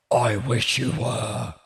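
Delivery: slow, dry deadpan drawl; deadpan put-down. Acted. Support agent coolly insults the speechless customer, slow deadpan. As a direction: let the insult drip out slowly. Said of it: "I don't know why I said it like that".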